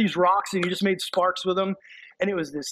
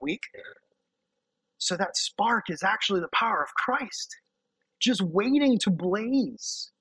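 normal talking voice

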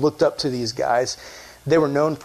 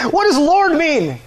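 high, slow, loud demand; mock yelling at an unresponsive crowd; 11 semitones higher, 4 dB louder, slower than usual. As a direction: holler the question, drawn out. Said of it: "Maybe I just wasn't loud enough".

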